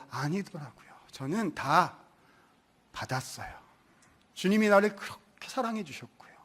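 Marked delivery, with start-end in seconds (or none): 0.1-0.9 아니더라고요 repeated softly, falling away; quiet echo. Shown as a demonstration. 깨달음을 조용히 되뇌는 설교자, 속삭이듯. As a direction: let 아니더라고요 fade almost to nothing.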